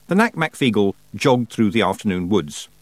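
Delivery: plain reading voice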